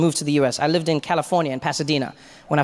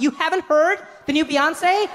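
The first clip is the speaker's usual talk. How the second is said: high, slow incredulous question; mock disbelief; 13 semitones higher, slower than usual. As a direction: draw it out as if utterly shocked. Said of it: "they can't believe you haven't had".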